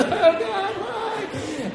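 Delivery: very high, hammed-up sobbing wail; high comic crying that fades away; mock weeping. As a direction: wail like a badly heartbroken girl, exaggerated. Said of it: "she just starts weeping".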